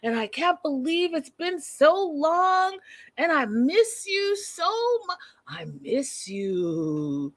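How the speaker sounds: swooning sappy lover; mock lovesick couple. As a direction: drag out the lovey-dovey words. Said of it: "saying, you know".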